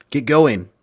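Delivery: urgent order. Acted. Urgent order to the getaway driver.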